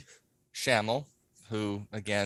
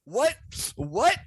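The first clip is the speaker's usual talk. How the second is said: confused what; 13 semitones higher, slower than usual. Retold or imitated. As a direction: squeak out a baffled what what. Retold Shook's confused cry.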